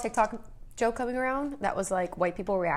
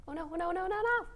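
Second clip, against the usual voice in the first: alarmed; 10 semitones higher, 5 dB quieter than usual. Real, not acted. Squeezed the pet too hard.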